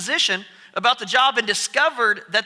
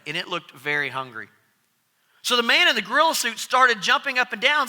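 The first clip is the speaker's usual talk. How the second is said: ordinary talk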